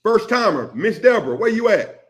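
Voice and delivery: high, loud excited shout-out; hype welcome. Real, not acted. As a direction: hype up the newcomer loudly. Welcoming a first-time viewer.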